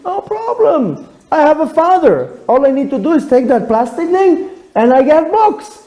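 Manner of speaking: put-on high, carefree little girl; child voice. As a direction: chirp it brightly like a confident kid. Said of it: "tells her".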